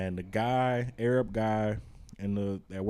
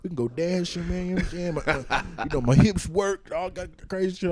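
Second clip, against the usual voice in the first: lightly voiced flirt, pitched up; flirting Cuban guy; 8 semitones higher, 4 dB louder, faster than usual. Teasing co-host about the flirting man, mock-flirty imitation. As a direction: imitate his eager come-on, higher than usual. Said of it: "he was flirting with you".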